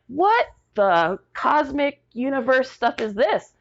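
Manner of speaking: high, slow bemused disbelief; incredulous. Retold or imitated. Answering her mother-in-law, high slow incredulity. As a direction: drawl it out, baffled. Baffled by mother-in-law's theory.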